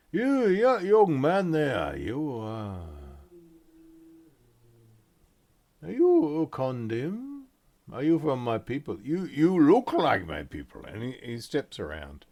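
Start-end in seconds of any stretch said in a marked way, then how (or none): 0.1-10.8 merchant voice. Acted. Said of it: "he goes".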